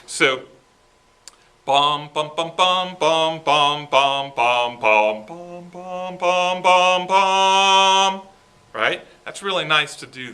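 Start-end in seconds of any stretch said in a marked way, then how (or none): 1.6-8.2 soft to loud crescendo. Shown as a demonstration. Director showing a crescendo from piano to forte, building sung bums. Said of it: "experiment with bringing the band down before coming back up".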